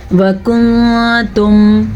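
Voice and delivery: وکنتم with the noon hidden in a long nasal hum; very slow recited وکنتم, noon concealed; ikhfa. Shown as a demonstration. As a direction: hold a nasal hum before "tum" in وکنتم. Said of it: "اخفا کا قاعدہ ہو رہا ہے".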